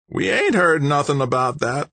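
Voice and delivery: high sandpaper voice; raspy old man. A narrator's reading. Old man doubting the stranger's news, raspy. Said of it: "His voice sounded like an old-time diesel rig".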